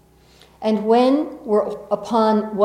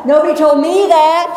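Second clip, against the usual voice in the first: high and loud through laughter; laughing; 8 semitones higher, 10 dB louder than usual. Real, not acted. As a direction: say it while laughing hard. Laughing at the man's rule.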